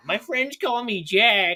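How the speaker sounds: high, slow put-on character voice; drawn-out, high mock introduction; old man character. Old prisoner introducing himself to Puss, high slow character voice.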